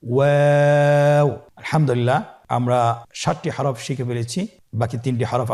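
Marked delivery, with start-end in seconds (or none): 0.0-1.3 ওয়াও stretched long with rounded lips, a little louder; drawn-out letter name. Shown as a demonstration. Teacher introducing the next letter, long drawn-out ওয়াও. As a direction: draw out ওয়াও for three counts. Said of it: "আবার বলুন".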